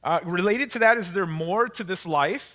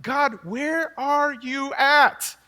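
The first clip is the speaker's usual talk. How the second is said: slow plea to God; 4 semitones higher, much slower than usual. Retold or imitated. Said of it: "you're like".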